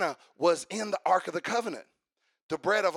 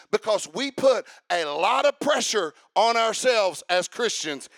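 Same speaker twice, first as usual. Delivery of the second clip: loud, high, rising sermon climax; full-force preaching; 3 semitones higher, 8 dB louder than usual. Real, not acted. Preacher firing up the congregation, shouting. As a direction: preach it to the rafters, building to a shout.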